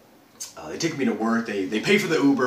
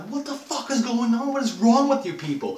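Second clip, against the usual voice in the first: high, indignant retold outburst; outraged yelling; 13 semitones higher than usual. Untied victim confronting his captors, outraged. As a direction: re-enact an incredulous, high-pitched rant. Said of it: "we're having this big yell and fuss".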